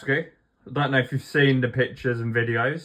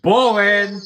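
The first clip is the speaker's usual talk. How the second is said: mock shout; 9 semitones higher, 8 dB louder than usual. Mocking the sprint race format.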